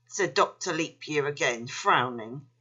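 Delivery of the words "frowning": ordinary delivery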